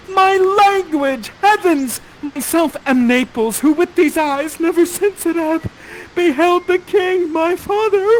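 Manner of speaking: overjoyed weeping Ferdinand. Acted. Host hamming up Ferdinand crying on the first date. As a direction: wail it like a tearful prince. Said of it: "You say".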